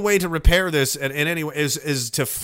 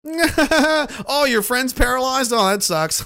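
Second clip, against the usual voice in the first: mock indifference; 7 semitones higher, slower than usual. Mocking the careless general.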